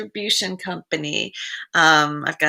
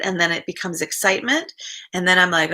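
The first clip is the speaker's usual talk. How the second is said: ordinary talk, nothing marked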